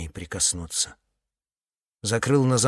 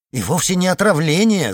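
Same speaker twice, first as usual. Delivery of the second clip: agitated; 7 semitones higher, 6 dB louder than usual. Acted. Iceman blurts correction.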